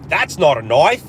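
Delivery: put-on loud, swooping Aussie accent; hammed-up Australian movie quote; mock Australian accent. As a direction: exaggerate the Australian vowel in "knife". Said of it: "that's in our D N A".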